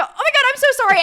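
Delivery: high, loud flustered sorry; excited apology. Retold or imitated. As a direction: blurt the apology brightly. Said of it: "I go".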